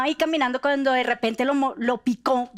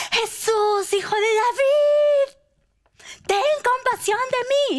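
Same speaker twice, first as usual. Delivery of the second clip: high, loud, drawn-out plea; crying out; 14 semitones higher, 6 dB louder, slower than usual. Acted. Blind beggar begging Jesus from the roadside, crying out. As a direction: call out to him, stretching the words. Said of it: "comenzó a gritar".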